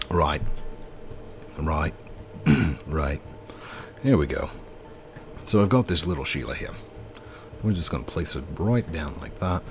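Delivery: put-on low Australian accent, fairly soft. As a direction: play a low-voiced Australian bloke. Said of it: "Do the Australian voice".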